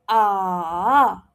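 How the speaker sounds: AH dropping and then rising, loud; drawn-out V-shaped AH; third tone. Shown as a demonstration. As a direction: dip AH down and swing it up. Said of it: "it drops and then it rises".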